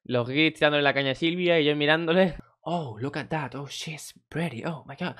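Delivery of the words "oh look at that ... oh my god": slow, hushed English tourist leering; hushed leering tourist drawl, half mocking; horny tourist. Tourists flirting with Silvia, imitated in a slow hushed leer. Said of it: "me hablaba todo cachondo perdido".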